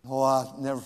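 normal talking voice

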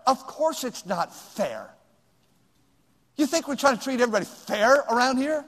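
usual speaking voice

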